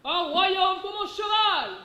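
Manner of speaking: slow, high, loud declamation; Richard III ranting. Retold or imitated. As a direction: cry out like a desperate king. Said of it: "si tu préfères".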